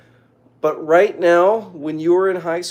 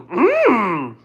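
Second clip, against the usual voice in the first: high, loud croaky hum; drawn-out high creature hum; Yoda; 8 semitones higher, 5 dB louder than usual. Acted. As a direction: hum it like a wise little alien. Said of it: "Do a Yoda impression".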